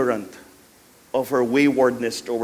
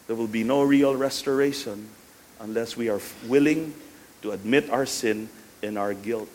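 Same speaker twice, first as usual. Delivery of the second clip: ordinary talk, nothing marked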